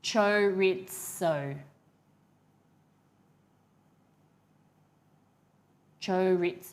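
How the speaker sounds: slow "chorizo" with a plain ts for the z; "chorizo" said slowly as cho-REET-so; salesperson's chorizo. Retold or imitated. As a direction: draw out "chorizo" syllable by syllable, ts in the middle. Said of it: "when the chorizo salesperson pronounced".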